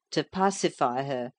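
plain reading voice

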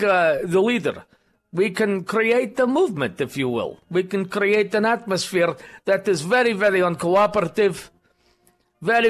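deliberate put-on Russian accent. Radio host posing as a Russian party member, Russian accent. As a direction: play a Russian party loyalist. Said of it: "work on your Russian accent".

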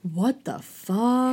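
shocked. Real, not acted.

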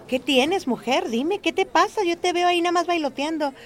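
concerned friend calling out loud across the market. Retold or imitated. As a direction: call out to a fidgety friend, worried. Marta calls to anxious narrator.

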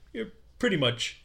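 high, deadpan De Niro shrug; De Niro impression. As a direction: toss it off like De Niro. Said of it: "De Niro's perfect delivery".

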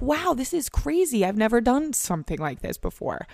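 high, awed voice; wide-eyed amazement. Acted. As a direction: marvel at it like it's brand new. Acting amazed at new experience.

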